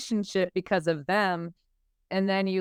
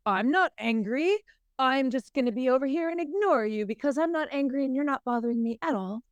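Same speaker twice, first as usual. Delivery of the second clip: higher-pitched mock-British denial of anger; airy British voice, pitched up; 6 semitones higher than usual. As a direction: put on a British accent, insisting you're unbothered. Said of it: "I just got a British voice".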